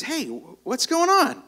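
high, drawn-out sparrow voice; chirpy little bird popping in, high-pitched; sparrow character. Acted. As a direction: call out slowly and high as a sparrow. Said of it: "he pops out and goes".

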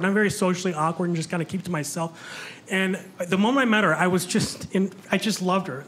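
usual speaking voice

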